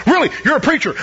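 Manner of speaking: high-pitched mock disbelief; startled double take, retold; surprised. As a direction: act out a taken-aback coworker. Startled coworker learns he is preacher.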